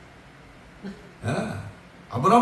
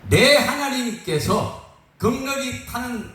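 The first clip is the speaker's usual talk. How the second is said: slow, solemn, defiant proclamation; bold declaration; 4 semitones higher, slower than usual. Acted. Three friends answering the king in faith, bold slow declaration. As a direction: proclaim it slowly and unshakably to a king.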